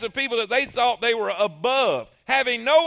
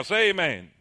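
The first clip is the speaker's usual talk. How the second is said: usual speaking voice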